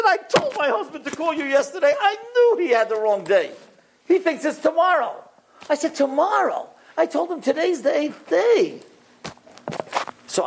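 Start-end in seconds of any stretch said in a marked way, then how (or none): 0.0-5.2 high, exasperated wife's outburst. Retold or imitated. Retold wife exasperated at husband. 5.9-8.8 high-pitched, incredulous retort; incredulous protest. Retold or imitated. Retold incredulous reaction to wrong day.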